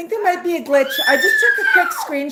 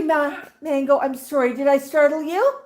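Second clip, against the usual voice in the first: ordinary delivery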